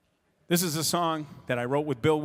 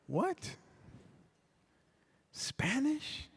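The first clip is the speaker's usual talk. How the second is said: stunned disbelief; 11 semitones higher, 10 dB quieter, much slower than usual. Retold or imitated. Stunned reaction to the request.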